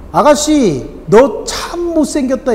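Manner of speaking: high, slow taunting bird voice; mocking parrot. Parrot jeering at a passing lady, high taunting voice. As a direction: sneer at the woman like a cheeky bird.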